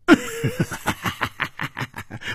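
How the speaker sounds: high, hearty burst of laughter; loud laugh. A narrator's reading. Butterfly Flower laughing off the warning, loud high laugh. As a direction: throw the head back and laugh out loud. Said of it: "大笑着说".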